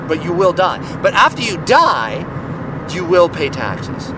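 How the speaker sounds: ordinary delivery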